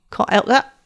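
mildly hammed-up rough bully. A narrator's reading. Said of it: "he repeated roughly".

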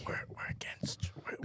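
soft unvoiced whisper, like a secret; whispered aside. To the co-hosts, sneaking in a joke as a whisper. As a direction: hush it as if the mic might hear.

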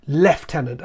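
"lieutenant" with a clear LEF at the start; British forces' "lieutenant", with an F sound; firm British pronunciation. Shown as a demonstration. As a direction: say "lieutenant" as lef-TEN-ant. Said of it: "in the British forces, it's very firmly".

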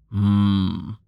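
low rumbling hum; deep wordless rumble. Acted. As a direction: rumble a slow, deep hmm. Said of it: "Tallheart rumbled".

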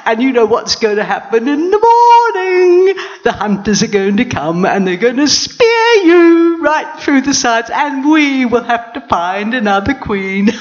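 high, loud gloating hyena voice, half singing; mocking hyena. Hyena gloating over the trapped lioness, loud mocking voice. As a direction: taunt her, cackling with glee. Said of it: "a hyena came along and started laughing".

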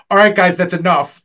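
loud, mock-stern cutoff; fed-up teacher. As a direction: imitate a teacher shutting down the class. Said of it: "teacher would get fed up".

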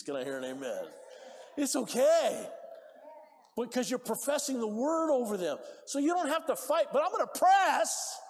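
loud, high, driving preacher voice; fiery preaching. Acted. Preacher urging the church to declare God's word, fiery and loud. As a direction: preach it hard to the congregation.

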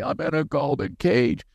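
imitated tearful wail; badly faked sobbing complaint, thinner voice; mock crying. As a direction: mimic a wife crying. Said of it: "my wife would cry".

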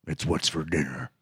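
deep beef-ad cowboy narration; Sam Elliott. Retold or imitated. Continuing Sam Elliott impression.

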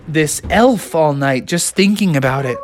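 ordinary delivery

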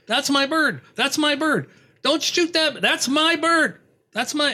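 high-pitched mock yelling; imitated yell. Hunter claiming the pheasant from hunters, imitated yelling. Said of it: "and he's yelling".

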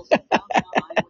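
a breathy giggle that jumps way up; high airy laugh. Real, not acted. To the God in his mind, a high breathy laugh. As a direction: let a light, high laugh escape. Amused by imagined God's teasing.

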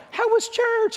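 high-pitched imitation of a mom; lightly teasing, very high motherly greeting; chirpy mom. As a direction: ask it in a sing-out mock mom voice. Mom cheerily greeting him in car.

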